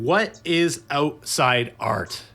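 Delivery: slow, spaced-out delivery; drawn-out showpiece question; grand first question. Pretend. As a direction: pose it word by word, with weight. Grandly posing his first question.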